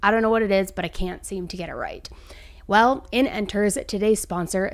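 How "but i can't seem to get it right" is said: breathy whisper, half to herself; whispered aside. Real, not acted. To viewers about never picking right, whispered aside. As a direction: let the frustration drop into a whisper.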